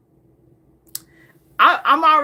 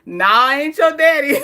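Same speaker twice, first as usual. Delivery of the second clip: laughing dad quote; 4 semitones higher, 7 dB louder than usual. Retold or imitated. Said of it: "my dad tells Queen Sheba".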